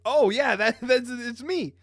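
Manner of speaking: high, excited burst with a laugh; excited recognition. Retold or imitated. Reacting to a favorite character, excitedly high.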